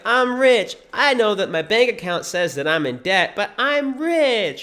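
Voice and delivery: high, insistent parody of denial; mock positivity. Mocking a believer in debt insisting otherwise, deluded cheer. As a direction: hammer it out high, stretching the ending.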